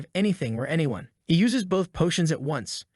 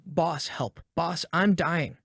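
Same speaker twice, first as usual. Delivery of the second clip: usual speaking voice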